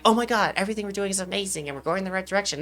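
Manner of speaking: quick, gushing fake cheer; fake positive. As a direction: rattle off hollow over-the-top praise. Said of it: "you gotta be fake positive".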